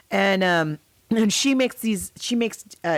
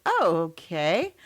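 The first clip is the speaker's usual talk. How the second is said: slow meek reply; 3 semitones lower, much slower than usual. Retold or imitated. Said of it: "she's like".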